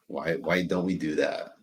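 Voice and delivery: ordinary delivery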